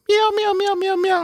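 very high little meows; squeaky kitten mewing. Retold or imitated. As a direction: mew like a tiny kitten. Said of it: "it's a bunch of kittens".

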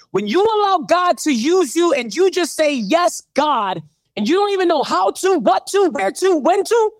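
fervent pulpit crescendo, voice up high; preaching at full force. Real, not acted. Preaching build to climax.

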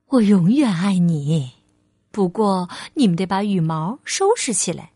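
low, tender motherly voice; loving mother, reassuring. Acted. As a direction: reassure your little one sincerely.

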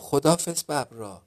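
calling to the tigers far away; loud shout. A narrator's reading. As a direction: shout it out, hands round the mouth. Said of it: "با صدای بلند داد زد".

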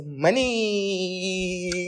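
long, drawn-out final vowel; savoring. Real, not acted. Listing love languages to friends, slow and relishing. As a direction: stretch the word out playfully. Comic drawn-out naming of a favourite.

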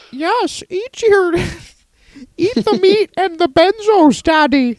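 very high caricature of a coaxing daughter; high put-on voice urging Daddy to eat; mock sinister daughter. Daughter feeds her father meat and pills, high caricature. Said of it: "She's like".

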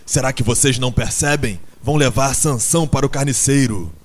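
loud, urgent outburst; alarmed exclamation. A narrator's reading. Benjamin warns the animals about the butcher, loudly alarmed. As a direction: raise your voice to make them understand.

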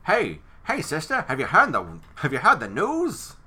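old-movie Mid-Atlantic accent, hammed up. Shown as a demonstration. Movie critic mocking the Mid-Atlantic accent, in a hammed-up Mid-Atlantic voice. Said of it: "It's like that fast-talking, like, stilted way".

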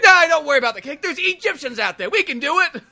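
high, loud, urging character voice; young Sherlock Holmes. Acted. Young detective talking sidekick out of cake, high and pushy. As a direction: egg him on in a high, pushy voice. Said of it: "He's like".

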